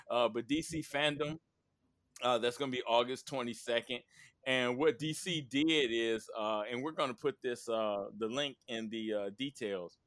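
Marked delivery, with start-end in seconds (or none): none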